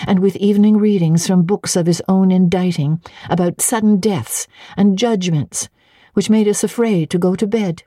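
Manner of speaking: put-on frank young governess. A narrator's reading. Jane lists her grievances.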